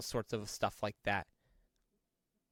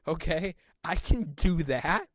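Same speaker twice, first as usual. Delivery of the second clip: mock eager, higher, louder and slow; eager shepherd boy; 6 semitones higher, 5 dB louder, slower than usual. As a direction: agree slowly like a keen kid. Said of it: "He's like".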